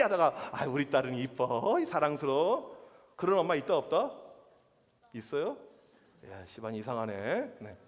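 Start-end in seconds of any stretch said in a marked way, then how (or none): 0.5-2.8 low, cooing mock affection; doting mother. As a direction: gush over a beloved daughter, hammed-up. Mother suddenly doting on her daughter. (딸을 예뻐하는 엄마처럼 다정하게)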